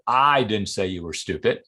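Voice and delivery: "I" picked out, the rest trailing after it; stress on I. Shown as a demonstration. Demonstrating that maybe somebody else said it, stress on "I". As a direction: lean on "I", as if someone else said it. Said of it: "emphasizing five different words in the phrase".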